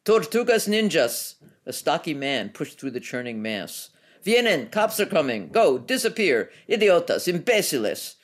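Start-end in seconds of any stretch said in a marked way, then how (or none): 0.0-1.4 high, loud, slow bellow. A narrator's reading. Stocky man bellows over the noise of the fight. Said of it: "a new voice that bellowed". 4.2-8.2 yelled warnings. A narrator's reading. Stocky man yelling warnings.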